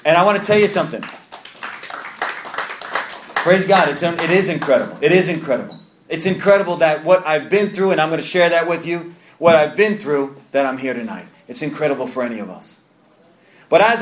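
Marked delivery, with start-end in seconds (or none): none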